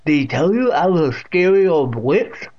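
loud deaf great-aunt's voice; Catherine. A narrator's reading. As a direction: speak out distinctly as the deaf aunt. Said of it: "with that distinct pronunciation".

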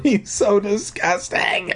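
high slow wail. Acted. Deirdre wailing to Wendy about the monster. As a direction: wail it in misery. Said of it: "Deirdre wailed".

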